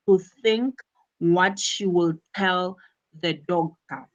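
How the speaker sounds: ordinary talk, nothing marked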